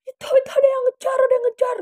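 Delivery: high, loud panicked wail; imitated frightened screaming. Friend warning rider of a pursuer, frightened mock scream. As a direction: act out a terrified warning cry. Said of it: "terus teriak-teriak terus".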